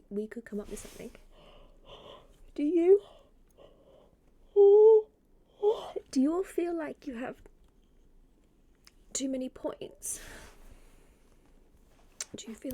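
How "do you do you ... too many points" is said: high, loud question with long gaps; exaggerated questioning. Real, not acted. Streamer polls chat on spare points, exaggerated questioning. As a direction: pitch it up and break it into pieces.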